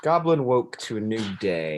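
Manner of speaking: lightly voiced read-aloud line; read-aloud narrator voice; storybook narration. A narrator's reading. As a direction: tell it to the children, gently paced.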